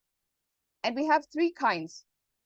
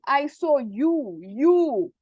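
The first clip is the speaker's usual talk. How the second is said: slow, the second you louder; stress on YOU; 3 semitones higher, 5 dB louder, much slower than usual. Shown as a demonstration. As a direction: hit the repeated you hard. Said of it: "Like I'm stressing".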